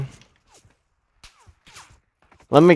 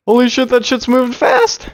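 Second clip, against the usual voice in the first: sudden high excited outburst; startled exclamation; 10 semitones higher than usual. Real, not acted. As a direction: blurt it out in shock. Startled gamer seeing fast zone.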